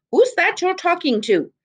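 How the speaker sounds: high, worried calling out; anxious call. A narrator's reading. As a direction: call from the cottage, suspicious and worried. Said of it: "an anxious voice called".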